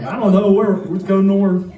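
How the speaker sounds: put-on high, loud Southern-accented outburst. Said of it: "in my southern accent".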